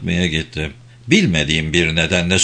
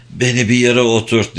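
ordinary delivery, nothing marked

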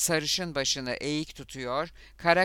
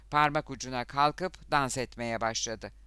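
usual speaking voice

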